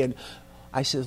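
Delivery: ordinary delivery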